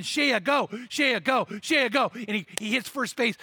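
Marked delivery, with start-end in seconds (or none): 0.0-2.1 calling out Shea, go a bit louder; mock crowd yell. Preacher voicing the crowd yelling to Shea, go. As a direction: cheer him on like the crowd. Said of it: "they're starting to scream his name".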